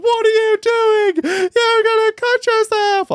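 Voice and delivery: high, slow, shrill-pitched imitation of a worried mum; mum's panicked high voice. Son quoting his mum begging him to stop, high and slow. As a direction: mimic mum fretting over the knife. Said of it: "My mum was in tears".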